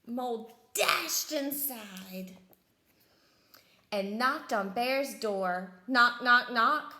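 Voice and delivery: loud, deliberate narration with punched knocks; dramatic storytime reading; animated story read-aloud. A narrator's reading. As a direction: bang out each knock with the voice. Reads picture book aloud.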